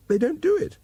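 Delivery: sudden high squeak of outrage; mock-indignant high pitch. A narrator's reading. Jessica fumes at Lockhart, squeaking high.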